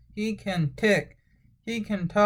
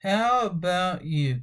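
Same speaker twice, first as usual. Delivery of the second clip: ordinary delivery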